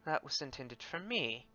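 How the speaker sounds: very soft, barely voiced mutter. Game master voices Ulthun looking wide-eyed at the cloak, muttering. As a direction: murmur it as if to yourself. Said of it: "and he mutters".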